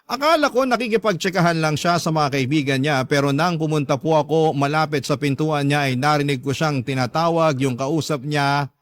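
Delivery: ordinary delivery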